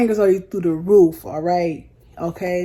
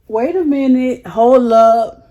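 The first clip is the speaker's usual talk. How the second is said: higher, louder, caught off guard; stunned wait a minute; 5 semitones higher, 9 dB louder, slower than usual. Retold or imitated. Retelling to viewers how she reacted to the queue jumper, higher and louder in disbelief. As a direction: stop everything, disbelief at the line cut. Shocked when the man cuts in line.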